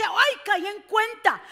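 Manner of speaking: a hint of the psalmist crying out; psalmist realizing. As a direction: voice the psalmist's dawning faith. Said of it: "Él dice".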